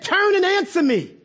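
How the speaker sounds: high, upset demand; upset, impolite demand. Acted. Upset David crying to God, high insistent demand. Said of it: "I don't think he said that really politely".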